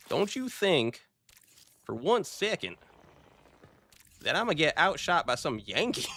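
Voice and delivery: a light southern boast; southern accent. Pretend. Streamer imitates southern commenter, mild southern accent. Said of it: "with a southern accent".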